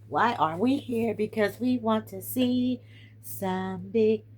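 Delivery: high, slow, stretched-out words; slow sing-song build-up; drawn-out tease. Acted. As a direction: draw each word out like a game-show reveal. Playful drawn-out announcement.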